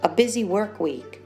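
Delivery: usual speaking voice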